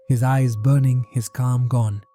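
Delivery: ordinary delivery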